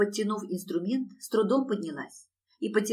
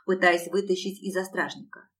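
plain reading voice

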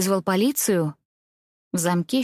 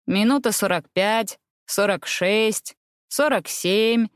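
counting from far off; slower than usual. A narrator's reading. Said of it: "считал в отдалении Бенедикт".

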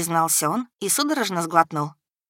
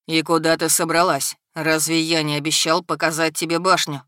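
authoritative dragon, cool and lowered; imperious dragon. A narrator's reading. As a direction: demand it like a lord. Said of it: "раздался властный голос дракона".

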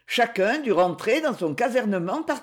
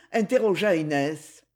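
usual speaking voice

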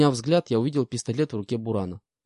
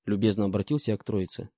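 plain reading voice

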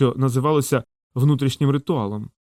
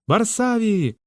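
high, drawn-out call of a name; crowd's answering cry; 9 semitones higher, much slower than usual. A narrator's reading. The crowd answers their leader, calling out high. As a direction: cry it out high, without full volume. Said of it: "була йому відповідь із кожної горлянки".

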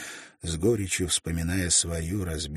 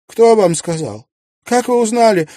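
high, loud panicked questions; frightened maid; 16 dB louder than usual. A narrator's reading. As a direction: caught out and scared. Испуганная служанка разоблачена. (испуганная служанка, высоко и громко)

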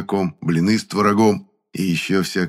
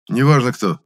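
higher, louder interruption; cutting off; 5 semitones higher, 5 dB louder than usual. A narrator's reading. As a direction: cut him off firmly. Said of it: "оборвал его Гудков".

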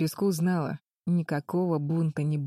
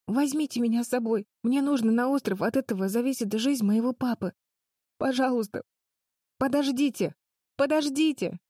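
desperate pleading; 7 semitones higher than usual. Acted. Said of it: "безнадёжными рыданиями Агни".

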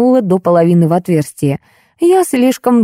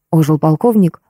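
ordinary delivery

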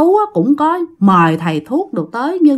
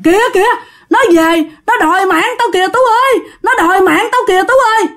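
terrified; 9 semitones higher, faster than usual. Retold or imitated. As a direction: cry out in terror at the dead girl's ghost. Dying mother sees the ghost.

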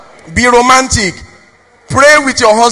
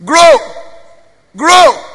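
called out; 5 semitones higher than usual. Real, not acted. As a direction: call the word out to the crowd. Preacher exhorting, calling out.

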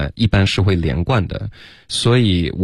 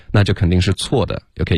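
ordinary talk, nothing marked

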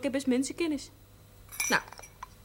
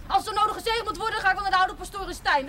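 high, heated quote of a grudge; quoting an indignant neighbour; 9 semitones higher than usual. Retold or imitated. Girl quotes the offended miner to the priest, high and indignant. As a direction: throw the neighbour's words back at him. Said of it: "Hij zegt".

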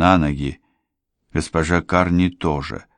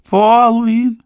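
высокий отчаянный вопль, повтор; снова вопи имя во весь голос; 7 dB louder than usual. A narrator's reading. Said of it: "завопил Морган". (отчаянный высокий вопль)

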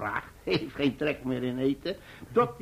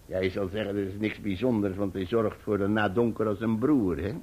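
usual speaking voice

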